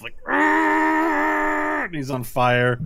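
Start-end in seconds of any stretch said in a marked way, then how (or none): 0.3-1.8 high, loud strained roar; straining roar. Acted. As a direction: heave with all your might. Burning enemy prying at scales.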